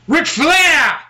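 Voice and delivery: high, loud preacher call; Dusty Rhodes promo call. Retold or imitated. Doing Dusty Rhodes calling out Flair, loud. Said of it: "I can actually do Dusty Rhodes".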